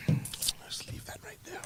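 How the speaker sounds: a slow whispered aside. Real, not acted. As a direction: hush it and let it drop, slowly. Drops the teasing topic.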